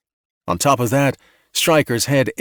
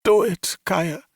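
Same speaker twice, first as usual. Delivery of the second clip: defeated; 9 semitones higher, slower than usual. A narrator's reading. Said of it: "in a low, defeated voice".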